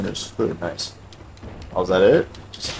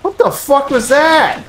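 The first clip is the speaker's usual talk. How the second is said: startled shriek; 3 dB louder than usual. Real, not acted. Startled by a sudden attack.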